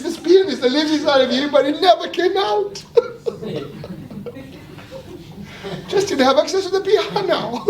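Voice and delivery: words bubbling with laughter; laughing while talking. Real, not acted. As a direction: talk through real giggles. Laughing through his own point.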